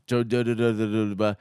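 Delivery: mock DJ chewing on mic; imitating a DJ eating a sandwich on air; talking while eating. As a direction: mumble through a mouthful. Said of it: "taking bites and going".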